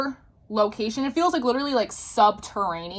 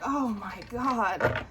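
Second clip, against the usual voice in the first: slow, drawn-out, softer disbelief; retold stunned dread; 7 dB quieter, much slower than usual. Narrator realizing the boy matches her dream, slow stunned reaction. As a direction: let each word sink in slowly. Retold shock at hearing his clothes.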